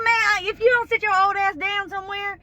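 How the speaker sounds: fed-up nurse yelling at her patient; exasperated yell. Retold or imitated. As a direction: chew out a cheeky old patient. She yells at Willie.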